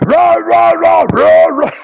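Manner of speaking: high mock roaring; scaring off a bear. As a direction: roar up high to scare it off.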